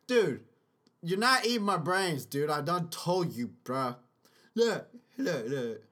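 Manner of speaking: usual speaking voice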